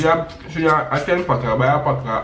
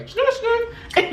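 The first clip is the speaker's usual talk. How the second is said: high slow polite reply; much slower than usual. Retold or imitated. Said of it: "I quickly was like".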